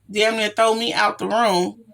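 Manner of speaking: normal talking voice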